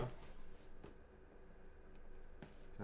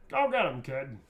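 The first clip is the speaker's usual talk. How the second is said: rousing catchphrase; 2 semitones higher, 7 dB louder than usual. Acted. Repeats the quote performed, after plain take.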